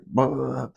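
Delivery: juddering "bah" for a skipping truck; imitated truck judder. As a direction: mimic the truck bouncing along the road. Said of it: "skipping across the goddamn interstate".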